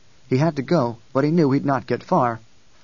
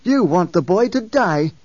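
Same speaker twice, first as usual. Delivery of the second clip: loud, higher slow accusation; accusing; 6 semitones higher, 5 dB louder, slower than usual. A narrator's reading. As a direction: hurl the accusation. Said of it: "Halleck whirled on him".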